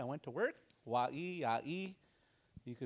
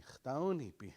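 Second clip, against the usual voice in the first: ordinary delivery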